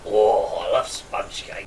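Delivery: low, hushed, slow murmur; restrained, murmured with pleasure; not over the top. Shown as a demonstration. Showing friend the toned-down version, low soft murmur. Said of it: "don't go over the top. Just".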